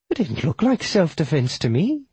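high widow voice, disputing; doubting widow. Acted. Widow doubts the killer's self-defense claim, high challenging voice. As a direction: challenge him in a high character voice.

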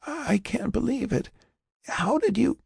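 soft, very high, faltering wonder; amazed, weak voice. Acted. Patient greets surviving friend, high frail amazement. Maria amazed Jack is alive.

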